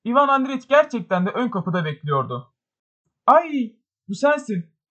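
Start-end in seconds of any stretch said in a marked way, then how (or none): 3.3-4.8 high-pitched startled exclamation; a jump up in pitch, mildly shouted; exclaiming. A narrator's reading. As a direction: cry out on recognising someone. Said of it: "diye haykırdı rakun kürklü beyefendi".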